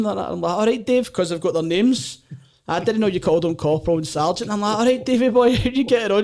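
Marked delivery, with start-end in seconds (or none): 0.5-1.1 higher, breezy overfamiliar hello; gallus cheeky greeting. Retold or imitated. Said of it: "Just this wee Gallus Glasgow guy". 4.8-6.2 higher and louder, laughing overfamiliar hello; cocky lad chumming up to his instructor; gallus cheeky greeting. Retold or imitated. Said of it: "Just this wee Gallus Glasgow guy".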